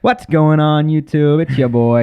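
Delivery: loud, high YouTube intro shout; Keemstar. Acted. Co-host asks for the intro, shouted hype impression. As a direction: hype up the viewers at full volume. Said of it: "how does he do his intros".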